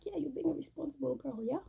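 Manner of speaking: high, loud teasing mother; mock mom catching her out. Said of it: "she'll be like".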